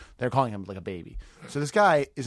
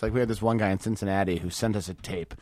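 ordinary talk, nothing marked

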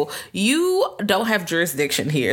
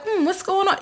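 high, sing-song innocent voice with a laugh; mock-innocent high voice; sweetly curious. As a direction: play a cheerfully clueless islander. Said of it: "They're like".

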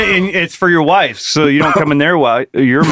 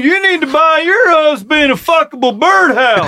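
Doctor Phil; 10 semitones higher than usual. Retold or imitated. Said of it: "Doctor Phil".

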